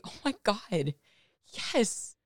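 high, thrilled yes; retelling a thrilled yes; very excited. Retold or imitated. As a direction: gush it out with excitement. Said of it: "And I said very excitedly".

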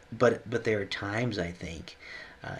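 ordinary talk

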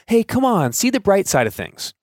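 overly chipper brush-off; mock cheery pep talk. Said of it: "instead of looking at them and saying".

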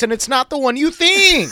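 laughing punchline. Real, not acted.

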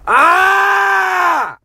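loud, high frustrated yell; real scream of frustration. Real, not acted. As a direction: let out a raw scream of rage. Furious search for sunglasses.

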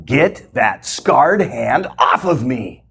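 slow, menacing put-on demon voice, stretched out; demonic male voice. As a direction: growl it out slowly like something inside her. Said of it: "came a male-sounding voice".

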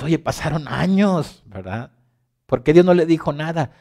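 exasperated question. Pretend. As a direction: demand an answer, raising the voice. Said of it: "Y tú dices".

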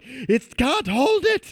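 high, slow strained shout; Apu impression. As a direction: squeal it like Apu panicking. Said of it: "Apu's like".

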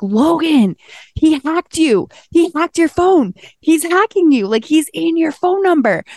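high, frantic alarm at the friend; frantic yelling. Retold or imitated. Telling Logan her phone got hacked, high frantic yell. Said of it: "Like, screaming at him".

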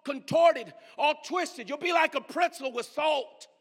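loud, driving sermon delivery; full-force preaching. Real, not acted. Preacher warns the church against pleasing people, full-force preaching. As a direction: preach it hard, punching the key words.

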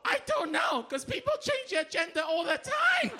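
mock outburst. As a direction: blurt it out at the test. Comic acting out confused test answer.

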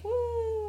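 high, drawn-out "woo"; mock spring break cheer; party whoop.

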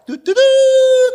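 high, loud sung horn blast; imitated trumpet horn. Preacher imitating the rapture trumpet for the congregation. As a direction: toot like heaven's trumpet sounding. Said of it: "he gonna blow the horn".

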